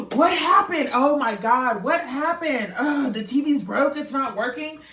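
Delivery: hammed-up loud, high-pitched fretting; mock panic. Imitating officers overreacting to the broken TV, mock panic. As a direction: overreact as if your own TV broke. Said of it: "act like it's their T V in their home".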